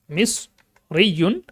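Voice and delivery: মিসরিয়ুন split after "mis", very slow; syllable-by-syllable demonstration. Shown as a demonstration. Teacher modelling the word, slowly split syllables. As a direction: pause in মিসরিয়ুন between mis and riyun.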